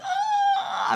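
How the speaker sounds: loud, high-pitched mock shriek; mock alarmed scream. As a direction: exaggerate a parent's overreaction. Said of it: "I wasn't like".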